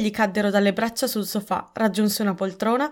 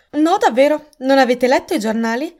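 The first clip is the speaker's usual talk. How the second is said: incredulous; 5 semitones higher, 6 dB louder than usual. A narrator's reading. Dinah reacts to the accusation.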